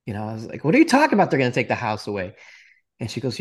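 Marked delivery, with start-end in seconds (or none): 0.6-2.4 high, loud, incredulous burst; shocked disbelief. Retold or imitated. Son stunned that the house will be lost, shocked disbelief.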